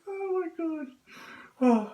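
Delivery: high, squeezed out after a laughing fit; helpless laughter. Real, not acted. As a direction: gasp it out through real laughter.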